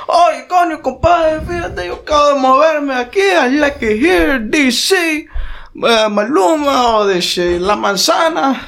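very high-pitched parody of a chattering roommate; roommate on phone. Retold or imitated. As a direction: mimic his phone talk in a high, stretched Spanish-English voice. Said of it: "he's talking on the phone loud as shit".

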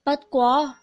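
ordinary delivery

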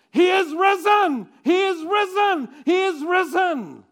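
high, loud jubilant shouts; triumphant proclamation. Acted. Mary telling the disciples, shouting with joy.

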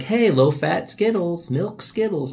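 high, slow mock announcer; mock ad pitch. Pitching milk Skittles as a mock candy ad. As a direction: sell it like a cheesy ad. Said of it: "It's like".

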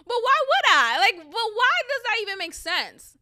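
high loud indignant questions. Real, not acted. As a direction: demand it, outraged and loud. Indignant rant.

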